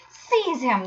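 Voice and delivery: high, urgent cry; outraged command; horrified cry. A narrator's reading. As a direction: cry out the order in alarm. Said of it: "they cried".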